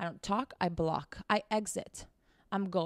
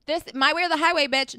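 loud, fast, higher-pitched hammed-up demand; mock bitchy ultimatum; 6 semitones higher, 8 dB louder than usual. Showing viewers what boundaries needn't be, mock bitchy. Acting out aggressive boundary-setting, then rejecting it.

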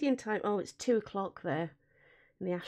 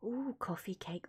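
awed whisper; 4 semitones lower, 13 dB quieter than usual. Real, not acted. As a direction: breathe the words in awe. Whispered awe.

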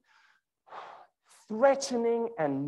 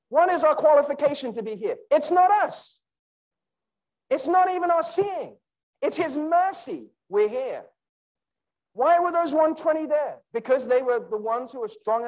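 preaching at full force; 9 semitones higher than usual. Real, not acted. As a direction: drive the point home to the church. Preaching climax.

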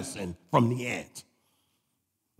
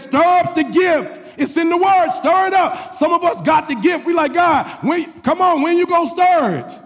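very high, loud shouted exhortation; full-force preaching; 13 semitones higher, 5 dB louder than usual. Real, not acted. Preacher urging church to stir up the gift, shouted exhortation. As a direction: hammer the verse home at a shout.

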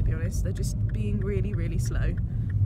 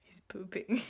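1 teasing whisper; 7 dB quieter, slower than usual. Real, not acted. Teasing about partner behind door.

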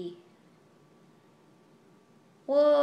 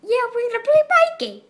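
much higher, louder, fast squeaky mockery; 12 semitones higher, 10 dB louder, much faster than usual. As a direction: squeak it out fast in a silly high voice. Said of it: "repeats line in put-on voice".

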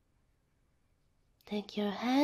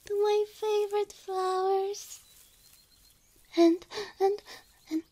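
moved to tears; 6 semitones higher than usual. Acted. Overwhelmed by the gift garden.

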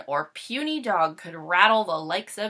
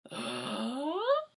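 gasp; 3 semitones higher, 5 dB quieter than usual. Acted. Sudden realisation.

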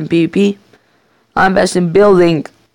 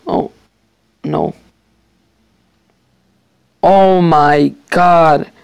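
shocked; 5 dB louder, much slower than usual. Real, not acted. Sudden shock in game.

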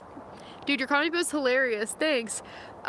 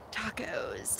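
whisper; 6 semitones lower, 10 dB quieter than usual. Real, not acted. Musing for a taco joke.

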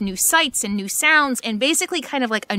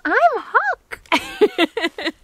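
voicing the puppy; 11 semitones higher, much slower than usual. Owner speaking as her puppy Huck in a high, slow, cute voice.

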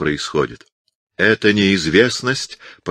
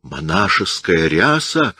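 ordinary delivery

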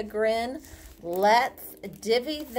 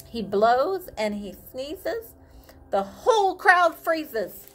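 put-on reading to children; 2 semitones lower than usual. Reading picture book aloud.

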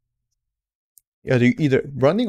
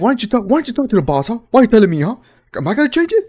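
higher, fast, indignant griping; mock griping coworkers; 9 semitones higher, 4 dB louder, faster than usual. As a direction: fire off exasperated questions, pitched up. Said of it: "this is them talking".

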